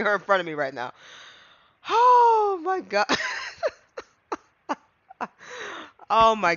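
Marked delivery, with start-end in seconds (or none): 3.1-3.7 high squealing burst of laughter; shrieking laugh. Real, not acted. Reacts to Von Karma appearing.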